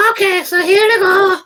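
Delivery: loud, very high falsetto panic; freaked-out, squeaky clerk voice; alarmed store clerk. Acted. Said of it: "And she's like".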